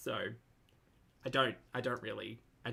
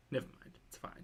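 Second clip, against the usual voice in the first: a resigned little whisper to herself; whispered aside; 12 dB quieter than usual. Real, not acted. Reacting to Anna's vlog, she drops the question in a whisper. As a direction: whisper it, giving up.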